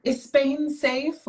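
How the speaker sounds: high, slow mock-concerned question; mock worried question. Mimicking a question nobody asks about Spain, mock worry. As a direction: ask it slowly and high, imitating a worrier.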